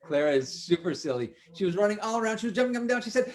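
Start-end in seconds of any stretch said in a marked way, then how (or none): none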